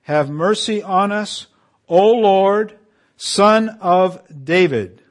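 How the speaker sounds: loud, slow plea, calling out; crying out for mercy. Acted. Beggars crying out to Jesus by the road, a loud, slow plea.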